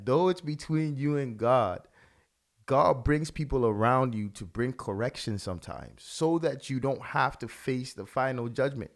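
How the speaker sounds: normal talking voice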